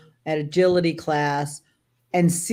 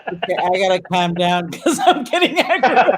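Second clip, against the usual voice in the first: laughing; 3 semitones higher, 6 dB louder than usual. Retold or imitated. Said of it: "'cause I'm like".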